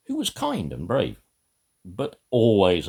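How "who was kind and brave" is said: usual speaking voice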